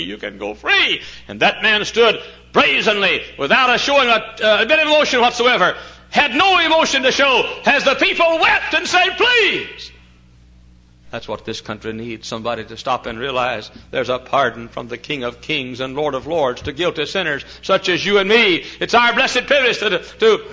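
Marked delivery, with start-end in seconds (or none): 0.0-9.9 loud, high, climbing pulpit cry; impassioned preaching. Real, not acted. Preacher retelling the prisoner's refusal, impassioned preaching. 15.8-20.4 louder, higher pulpit swell; rising preaching. Real, not acted. Preacher offering pardon to sinners, rising preaching.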